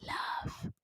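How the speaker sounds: whispered reveal. Real, not acted. Revealing the secret ingredient.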